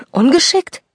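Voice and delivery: outraged disbelief at "Ungeschickt"; indignant echo. Acted. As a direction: repeat "Ungeschickt?" as a high, offended question. Ellie echoes indignantly.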